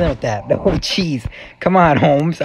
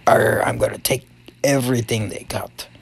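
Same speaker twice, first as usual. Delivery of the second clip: pirate; 4 semitones lower than usual. Acted. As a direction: lower pirate growl on the arr. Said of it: "and I'm a pirate".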